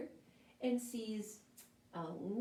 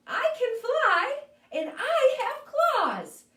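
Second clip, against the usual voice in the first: higher, louder, gleeful boast; triumphant plan; 10 semitones higher, 5 dB louder than usual. Acted. Crab-bird hatching their plan, gleeful high boast. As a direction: announce the grand idea proudly.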